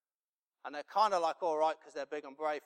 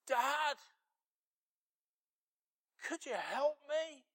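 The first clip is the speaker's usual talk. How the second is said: put-on high, plaintive child's plea; six-year-old calling out; 13 semitones higher, slower than usual. Six-year-old son to his dad across the field, high pleading call. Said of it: "He called out and he said".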